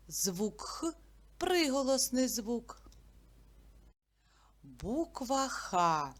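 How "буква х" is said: normal talking voice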